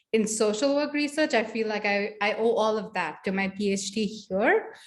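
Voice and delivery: ordinary talk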